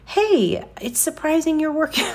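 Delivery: high, bright mock-chirpy greeting; chirpy small talk. Imitating her cheery greeting to the clerk. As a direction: play your own awkward cheery self. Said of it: "which sounds terrible".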